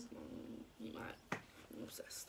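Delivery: breathy whispered tease; whispering. Acted. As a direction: whisper it close to the mic. Whispered aside to ex.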